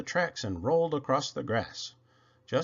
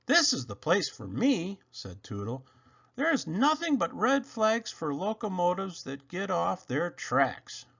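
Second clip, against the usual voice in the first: higher little engine voice; happy Toodle; 7 semitones higher than usual. Acted. Toodle decides the track is for him, high relieved voice.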